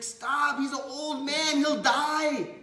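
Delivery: high, loud mock-desperate cry; alarmed plea. Sitarani begs Chaitanya to stop beating, alarmed.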